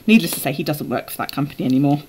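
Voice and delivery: normal talking voice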